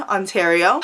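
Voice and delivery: ordinary talk, nothing marked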